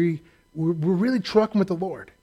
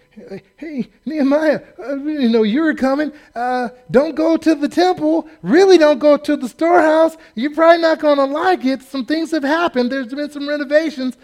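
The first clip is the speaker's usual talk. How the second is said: nervous warning; 9 semitones higher, 4 dB louder than usual. Pretend. Nervous people warn Nehemiah.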